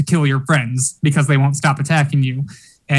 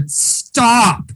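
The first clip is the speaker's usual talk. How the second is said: retold shout; 8 semitones higher than usual. Man shouting at his possessed attacker to stop, mock shout. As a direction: imitate a desperate cry without real volume. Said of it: "He just shouts at her".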